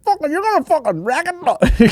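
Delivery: high-pitched imitation of a scolding; mock shouting dad. Podcaster mimics Irish dad scolding daughter, mock shouting. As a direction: give out to her in a put-on rant. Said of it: "the dad's giving out to her".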